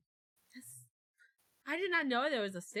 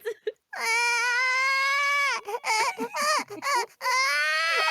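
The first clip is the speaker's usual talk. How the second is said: shrill shrieking laugh; high squealing laugh; 9 dB louder than usual. Real, not acted. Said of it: "it's a fucking baby".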